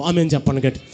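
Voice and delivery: loud, low urging cry; preacher shouting to the congregation; preacher's shout. Real, not acted. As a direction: rouse the crowd to answer loudly.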